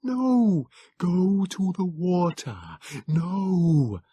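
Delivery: high, slow, small creature voice; frail Morlock pleading from a distance; small liquid Morlock voice. A narrator's reading. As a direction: let the words float out thin and liquid. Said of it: "a small, liquid voice came floating".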